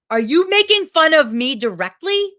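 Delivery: loud, high, hurt accusation; upset outburst. Retold or imitated. Actor confronting classmate with pillow belly, upset loud challenge. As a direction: fire it straight at the classmate. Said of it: "Like, I was so upset".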